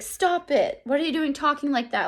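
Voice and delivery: a teacher voicing Piggy's pleading protest; alarmed Piggy. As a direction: cut him off in a panic. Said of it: "And Piggy's like".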